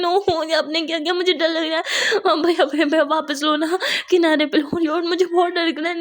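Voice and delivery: high, pleading panic near tears; panicked cries. A narrator's reading. Muskan on the yacht, terrified of the sea, high panicked pleading. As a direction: beg in terror to go back to shore. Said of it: "चिल्लाने लगती है".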